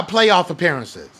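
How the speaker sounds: ordinary talk, nothing marked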